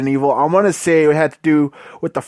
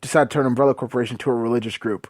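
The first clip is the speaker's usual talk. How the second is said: softer, fast, sarcastic mumble; mock deadpan disbelief; 6 semitones lower, 6 dB quieter than usual. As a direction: rattle it off quietly in sarcastic disbelief. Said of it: "you're just like".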